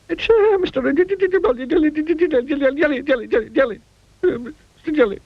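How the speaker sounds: high, stuttering frightened squeak; stammering. A narrator's reading. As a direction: stammer the name in terror. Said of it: "stammered Mister Jelly".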